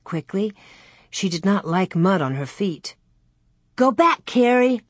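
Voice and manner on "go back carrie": high-pitched call of warning; urgent cry. A narrator's reading. Laura sends Carrie back from the slough, crying out. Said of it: "Laura cried".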